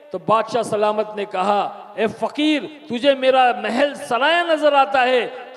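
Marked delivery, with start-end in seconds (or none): 2.0-5.5 slow, louder indignant king's demand; angry king. Retold or imitated. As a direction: raise the voice and scold the beggar. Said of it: "गुस्सा आया". (नाराज़ बादशाह, धीमे और ऊँचे स्वर में)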